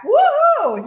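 high, loud celebratory cheer; gleeful whoop. Acted. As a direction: whoop as if you just landed a sale. Mimics her old delight at payers.

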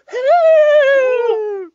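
high, loud falling ghost wail; falling scream. Retold or imitated. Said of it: "It sounds like you're falling at the end".

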